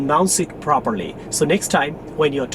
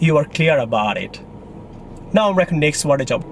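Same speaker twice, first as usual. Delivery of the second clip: ordinary talk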